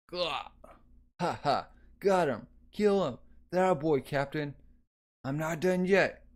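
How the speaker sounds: rally the fighters around you; excited battle cries. Acted.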